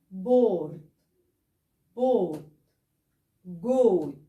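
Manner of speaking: each word with a rounded o. Shown as a demonstration. Teacher modelling rounded o words for students. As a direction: round the lips on each vowel. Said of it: "تعالوا نشوف الأمثلة دي".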